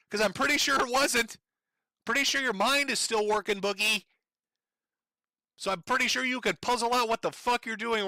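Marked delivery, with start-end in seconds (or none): none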